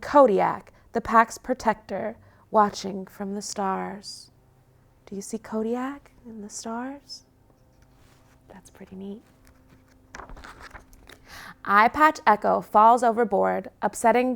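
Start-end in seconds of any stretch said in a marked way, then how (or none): 5.1-9.2 put-on hushed question to the children; soft aside. A narrator's reading. Reading to kids, a hushed aside. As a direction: ask the little listeners softly.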